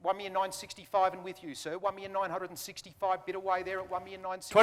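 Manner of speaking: brisk auction patter; auctioneer calling. Real, not acted. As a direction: rattle off bids like an auctioneer.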